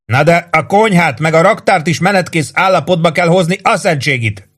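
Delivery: high-pitched exasperated officer's yell; narrated shouted objection; shouting. A narrator's reading. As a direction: yell up with a curse at the end. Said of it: "ordította Ross főhadnagy".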